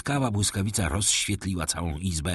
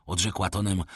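ordinary delivery, nothing marked

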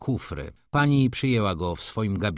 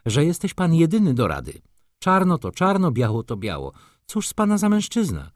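exasperated outburst; 10 semitones higher, 6 dB louder than usual. Acted.

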